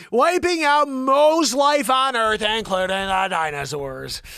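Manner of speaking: loud, slow announcer-style reading; news read. Acted. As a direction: project the story slowly, starting high and settling. Resumes reading science story.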